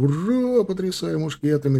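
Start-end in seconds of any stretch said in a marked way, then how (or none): 0.0-0.6 high joyous hurrah; cheering shout. Acted. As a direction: cheer in delight. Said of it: "кричали в восторге драгуны".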